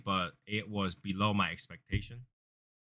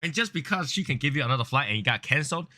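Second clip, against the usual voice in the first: usual speaking voice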